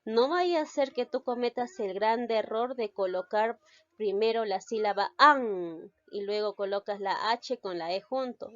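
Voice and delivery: ordinary talk, nothing marked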